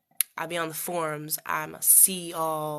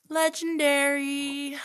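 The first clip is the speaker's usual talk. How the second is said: Legendary stretched out slowly, higher and louder; drawn-out loud legendary; 9 semitones higher, 7 dB louder, slower than usual. Shown as a demonstration. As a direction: belt out Legendary slowly and high. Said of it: "The legendary".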